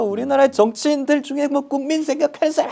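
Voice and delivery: high-pitched mock tirade at students; hammed-up yelling teacher, voice pushed way up; imitating ranting lecturer. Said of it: "소리를 질러요".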